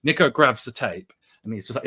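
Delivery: ordinary talk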